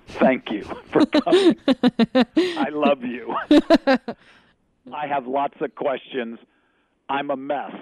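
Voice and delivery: high, put-on little-voice prayer; mock humble prayer.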